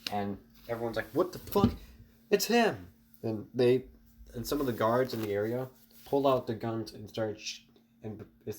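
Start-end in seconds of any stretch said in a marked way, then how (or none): 1.1-2.9 much higher, loud startled shout; alarmed shout. Acted. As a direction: yell it as you spot him. Everyone spots Deku at alarm.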